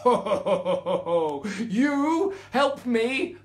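loud booming lion laugh; roaring laughter. Acted. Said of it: "roared again, only this time with laughter".

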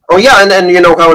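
ordinary talk

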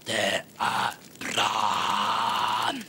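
very low, very slow booming roar; girl waking the town, deep drawn-out roar; shouting in her strange voice. Acted. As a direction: boom the alarm across the town. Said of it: "så ropte hun".

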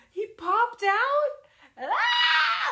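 high, loud, drawn-out squeal of amazement; excited child's scream. Retold or imitated. Niece hearing on the phone of the baby's birth, imitated excited scream. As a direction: imitate an overjoyed kid exploding into a shriek.

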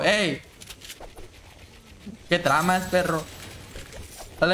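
normal talking voice

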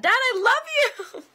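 high, loud, drawn-out shout-out; calling out to dad. Real, not acted. Calling out to her dad on the livestream, loud and high. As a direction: sing it out to your dad watching.

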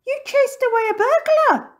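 very high, loud astonishment; amazed exclamation. A narrator's reading. Missus Plum learning burglar was chased off, high and amazed. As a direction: exclaim it in grateful disbelief.

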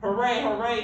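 high, loud hooray; shouted cheer. A narrator's reading. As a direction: cheer like a proud teacher. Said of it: "she shouted".

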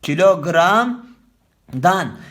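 kilogramdan stretched slowly and said loud; slow, loud teacher's dictation of kilogramdan; slow demo. Shown as a demonstration. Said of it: "Konuşma dilini esas alacaksın".

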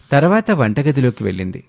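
plain reading voice

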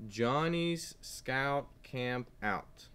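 put-on each word drawn out slowly for kids; slow title reading. Shown as a demonstration. Teacher reading a book title to young students, slow and clear. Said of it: "The title of this story is".

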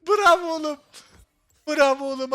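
loud, high cheer; cheering as if for the home team; excited praise. Real, not acted. Praising a teammate's play.

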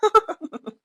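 short high burst of laughter. Acted. Husband laughing at why his wife cried, high amused laugh.

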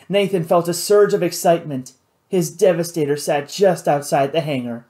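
plain reading voice

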